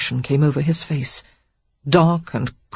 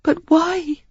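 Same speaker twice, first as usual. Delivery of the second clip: tearful plea; 13 semitones higher than usual. A narrator's reading. Sarah pleading, near tears.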